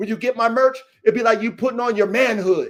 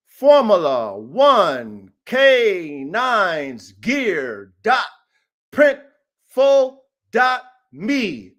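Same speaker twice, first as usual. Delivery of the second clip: slow spelled-out url; 3 semitones lower, 4 dB louder, much slower than usual. Shown as a demonstration. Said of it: "Type it in exactly the way I say".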